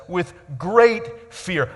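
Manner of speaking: "great" drawn out slowly and weighted; slow it down and lean on "great"; slow stress. Shown as a demonstration. Preacher dwells on the disciples' fear, slow stress. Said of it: "Now they are filled".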